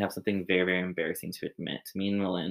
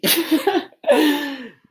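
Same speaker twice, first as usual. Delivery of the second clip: loud laugh; 8 dB louder than usual. Real, not acted. Host laughs after intro.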